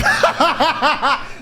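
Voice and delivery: real laugh. Real, not acted. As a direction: laugh out at the memory. Laughing at the weak punch.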